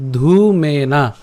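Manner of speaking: ধূমেনা drawn out slowly, each syllable clear; careful pronunciation. Shown as a demonstration. Teacher drawing out ধূমেনা slowly for the class. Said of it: "এর উচ্চারণটা হচ্ছে ধূমেনা".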